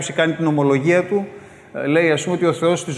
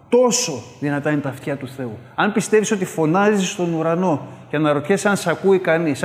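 ordinary talk

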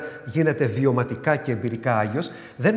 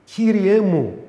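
earnest prayer cry; 6 semitones higher, 5 dB louder, much slower than usual. Retold or imitated. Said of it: "θα του πω".

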